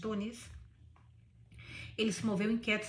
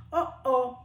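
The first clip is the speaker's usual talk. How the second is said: loud, raised exclamation; startled, louder outburst; sudden cry; 4 semitones higher, 7 dB louder than usual. A narrator's reading. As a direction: cry out with a peculiar intonation. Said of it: "gritou Billy de repente com uma entonação peculiar".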